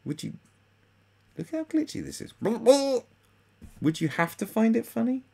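high mocking squeaky tone, teasing a socialist.

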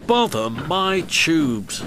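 a touch of puffed-out effort; panting. A narrator's reading. Old engine short of steam on the climb, mildly panting. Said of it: "he panted".

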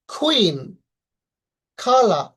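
"কুইন, খালা" with a strong puff after K; aspirated K. Shown as a demonstration. Teacher demonstrating C, K, Q words, aspirated K. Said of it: "এর উচ্চারণটা যদি দেখি".

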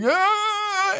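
high imitation of a bawling kid; drawn-out child's wail, put on; mock crying wail. Both sons crying when dad gets home, mock bawling. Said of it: "they're both crying".

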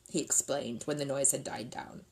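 plain reading voice

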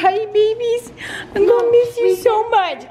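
high, pleading goodbye to pets; tearful goodbye. Real, not acted. Saying goodbye to the dogs.

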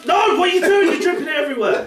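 high, laughing shocked cry; mock shocked wife. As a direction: squeal it in mock horror. Voicing wife's shocked reply.

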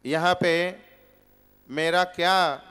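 normal talking voice

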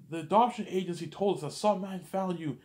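high, loud screaming rage; father disowning the boy, screaming high and loud; furious dad. Retold or imitated. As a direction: scream it down at the son like a raging father. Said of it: "He's like".

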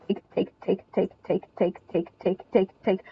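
low, quiet, even chant; soft, low staccato muttering; hushed repetition. Real, not acted. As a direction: repeat it under the breath like a ticking clock. Muttered repeated chant during play.

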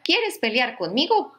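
grouchy ladybug. Acted. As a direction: taunt it like a cranky ladybug. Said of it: "le dijo la mariquita malhumorada".

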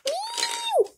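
very high, soft squeaky meows; tiny kitten mews. Pretend. Child pretending to be a kitten, very high soft mews.

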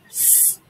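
just the s sound, no vowel; s as a bare hiss; isolated s sound. Shown as a demonstration. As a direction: hiss the s alone, unvoiced. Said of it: "tell me the first sound".

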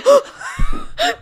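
high, helpless wheezing laugh; real out-of-breath cackle; breathless laughing fit. Real, not acted. Host losing it over the tickle fight, breathless laugh. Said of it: "I'm out of breath".

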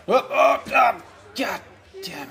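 sudden high frustrated yell; shouting. Real, not acted. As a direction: blurt it out in alarm.

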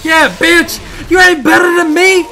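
high, loud gloating yell; taunting shout. Real, not acted. Gamer yelling at the defeated boss, gloating shout. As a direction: rub the win in his face. Taunting the beaten game enemy.

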